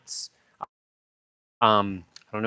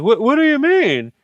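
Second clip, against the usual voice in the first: much higher, louder baffled movie guy; high-pitched incredulous question; dazed disbelief; 13 semitones higher, 5 dB louder than usual. Retold or imitated. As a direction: play the man who can't believe it. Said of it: "the guy's just like".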